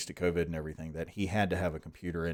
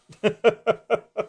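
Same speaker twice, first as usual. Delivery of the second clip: laughing; 13 semitones higher, 7 dB louder than usual. Real, not acted. Laughs at dead camera battery.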